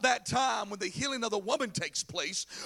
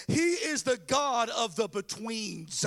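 ordinary talk, nothing marked

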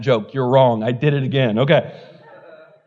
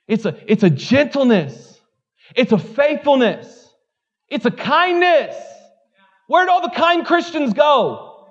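impassioned preaching; 8 semitones higher than usual. Real, not acted. Pastor urging the congregation toward kindness, impassioned preaching. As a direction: stretch each declaration out, pitched high.

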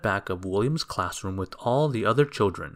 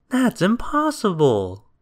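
high, loud and slowed, stretching out "That's impossible!"; a boy crying out in amazement at the impossible; astonished cry; 10 semitones higher, 5 dB louder, slower than usual. A narrator's reading. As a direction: cry it out in wide-eyed disbelief. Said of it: "cried William".

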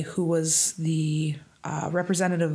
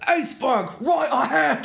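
lookout shout; 7 semitones higher than usual. Retold or imitated. Lookout calls iceberg warning.

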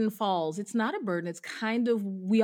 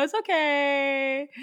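drawn-out, higher reassurance; 4 semitones higher, 4 dB louder, much slower than usual. Shown as a demonstration. Teacher soothing a kid at their shoulder, high voice. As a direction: coo it slowly up high. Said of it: "This is as high as it".

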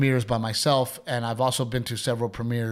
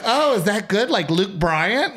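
higher, slow, wide-eyed asking; higher curious woman voice; 8 semitones higher, 4 dB louder, slower than usual. Retold or imitated. Telling how a woman quizzed the singer, higher and slow. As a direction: play the curious fan, pitched up. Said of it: "And she was like".

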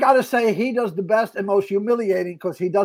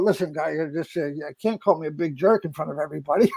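quiet rebuke; 6 semitones lower, 6 dB quieter than usual. Retold or imitated. Star quietly scolds co-actor.

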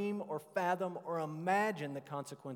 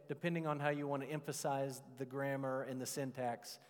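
ordinary talk

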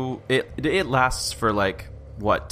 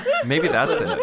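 laughing. Real, not acted. Laughing delight at the idea.

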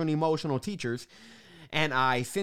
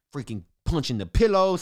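ordinary talk

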